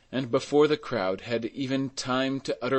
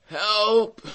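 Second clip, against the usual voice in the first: high, loud call for help; crying out for help; 11 semitones higher, 7 dB louder than usual. A narrator's reading. Old carter trapped under the cart, crying out. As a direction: cry out, pitched well up. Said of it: "cried old Fauchelevent".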